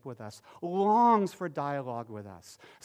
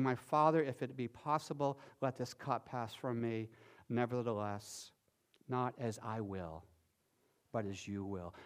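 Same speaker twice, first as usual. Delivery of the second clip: hushed prayer; 5 semitones lower, 7 dB quieter than usual. Retold or imitated. Jesus praying in Gethsemane.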